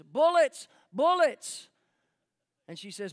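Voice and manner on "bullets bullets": shouted, much louder and higher; loud exclaim. Retold or imitated. Father calling out the translation to the listeners, shouted. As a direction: call it out like an urgent translation. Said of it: "and her father says".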